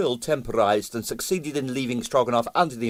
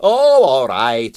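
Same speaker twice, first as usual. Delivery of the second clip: cheerfully; 3 semitones higher, 7 dB louder, much slower than usual. Acted. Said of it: "he admitted cheerfully".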